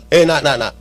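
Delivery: put-on God urging his child to forgive, low and tender; God's voice. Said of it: "Tuhan bilang".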